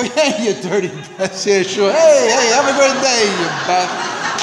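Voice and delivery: laughing through cheery insults at a passing driver; sweet-sounding curses with a laugh, window rolled up; mock friendly cursing. Mouthing insults at a passing driver, laughing and mock cheerful.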